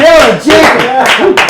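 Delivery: high, loud, drawn-out name announcement; host's shout-out. Real, not acted. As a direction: shout the comic's name for applause. Host calls applause for departing comic.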